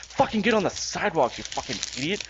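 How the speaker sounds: yelling in a rant; high angry outburst; angry shout. Real, not acted. Ranting at people walking in streets.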